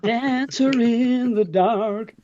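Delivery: slow, stretched "Dancer in the Dark"; slow drawn out. Pretend. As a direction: draw it out dramatically. Recalling a musical show.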